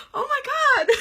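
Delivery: high, loud laughing cry; high laughing squeal through tears; laughing exclamation. Real, not acted. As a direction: burst out laughing mid-tears. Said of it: "I really did not mean to do this".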